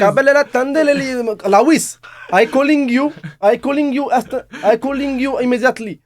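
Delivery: high, loud hammed-up Indian accent; urgent caller in mock Indian English. Shown as a demonstration. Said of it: "avec un accent indien". (accent indien caricatural, aigu et fort)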